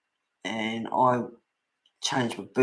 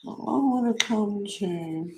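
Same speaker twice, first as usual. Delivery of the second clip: higher, slow whimpering plea; slow mock cat whimper; crying cat voice; 9 semitones higher, slower than usual. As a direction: voice the cat begging to come along. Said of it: "It sounds awful".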